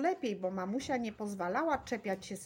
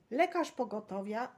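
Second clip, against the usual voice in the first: ordinary delivery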